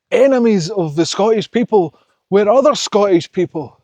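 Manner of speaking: rally them, fired up; impassioned. Real, not acted. Impassioned plea to Scots.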